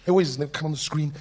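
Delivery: normal talking voice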